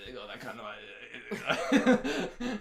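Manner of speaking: garbled mock mumble; slurred parody breaking into laughter; mumbled imitation. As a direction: imitate a mumbler, losing the words. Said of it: "That's exactly how they talk".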